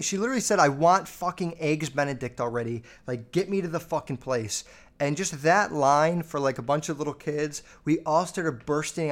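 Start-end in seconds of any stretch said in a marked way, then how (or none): none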